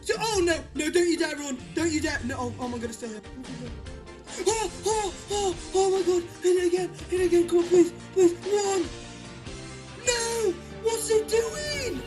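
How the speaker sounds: frantic high pleading; desperate gamer begging the catch; raging gamer. Real, not acted. Lapras catch fails, frantic pleading.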